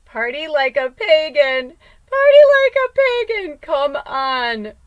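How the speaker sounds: loud rallying cry leaping up high; excited hype yell. Acted. Showing viewers the party find, excited hype yell. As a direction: belt it out, building to a high holler. Hyping up the product's name.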